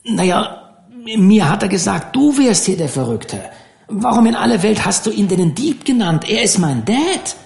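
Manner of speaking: lightly voiced young deckhand, half consoling; a hint of a teen fisher boy; boyish Dan. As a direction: talk to him like a friendly older boy. Dan defends his father.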